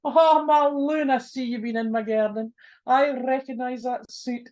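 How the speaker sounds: tickled minister teasing the lad; amused. Acted. Minister amused at the thief, laughing through it. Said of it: "he just laughed and he says".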